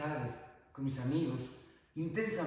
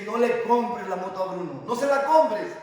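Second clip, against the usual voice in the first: raised, loud and higher warning, building; acted insistent plea; 5 semitones higher, 11 dB louder than usual. Mother begging husband not to buy the motorbike, insistent. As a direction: plead forcefully, louder on the repeat.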